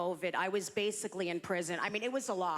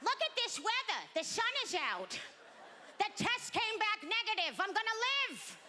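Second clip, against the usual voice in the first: put-on very old audience member; 9 semitones higher than usual. Old Florida retiree saying something positive, mock elderly voice. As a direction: imitate an elderly crowd, pitched way up. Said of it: "they all have the same face".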